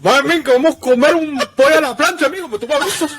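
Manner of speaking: high, lively Cuban neighbor voice; Cuban accent. Pretend. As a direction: greet like the chatty Cuban neighbor Roberto. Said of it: "I'm pretty good at the Cuban accent".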